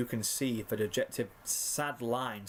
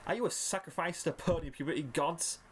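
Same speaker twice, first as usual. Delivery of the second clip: ordinary delivery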